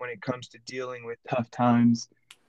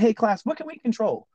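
higher-pitched classroom call-out; teacher prompting class; 6 semitones higher than usual. Retold or imitated. Teacher asks class to repeat back, imitated prompting call. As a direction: cue the class for a chorus answer, pitched up.

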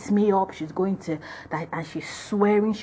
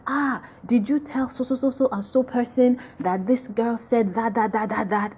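put-on higher-pitched accusing Nigerian-accented question; Nigerian intonation; 4 semitones higher than usual. Girls confronting Norma about the gossip, light Nigerian accent. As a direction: stammer out the accusation in a Nigerian lilt. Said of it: "Nigerian intonations".

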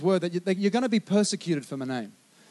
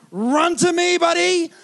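loud, high call across the park; 7 semitones higher, 6 dB louder, slower than usual. Retold or imitated. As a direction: yell for the boy to come running. Calling son away from dog.

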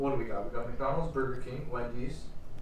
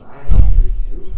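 loud protest; 13 dB louder than usual. Real, not acted.

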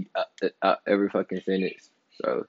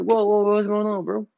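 mock startled; 12 semitones higher than usual. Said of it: "And I'm like".

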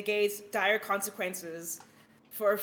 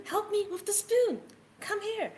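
much higher pleading kitten call; high desperate kitten plea; higher kitten cry; 10 semitones higher than usual. Acted. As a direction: push the kitten voice up, urgent. Kitten calling for help.

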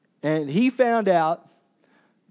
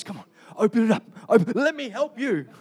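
lightly voiced God, fast and eager to help; a hint of an impatient, excited giver; eager gift giver; 4 semitones higher, faster than usual. Preacher voicing God urging the church to open the gift, lightly eager. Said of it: "he's like sitting here like this".

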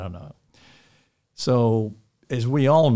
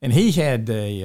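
ordinary talk

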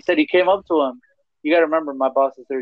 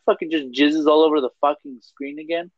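ordinary delivery

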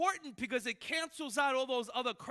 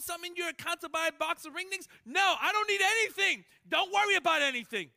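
loud, high, insistent; preacher shouting Paul's words; emphatic Paul; 6 semitones higher, 8 dB louder than usual. Retold or imitated. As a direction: hammer it home as Paul.

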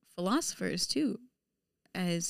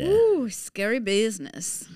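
slow, higher, louder playful dread; mock spooked; 4 semitones higher, 7 dB louder than usual. Answering co-host about college applications, mock spooked. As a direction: draw out a mock-scared ooh.

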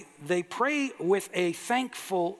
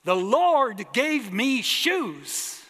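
much higher and louder, slow, ecstatic; woman at the front, shouting her thanks ecstatically; joyful shout; 11 semitones higher, 5 dB louder, slower than usual. Retold or imitated. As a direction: shout praise like a revival testimony. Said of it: "she shouted".

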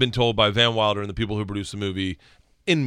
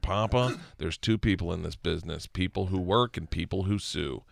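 sober advice; 6 semitones lower, 8 dB quieter than usual. Retold or imitated. Manager's sober advice on call, retold.

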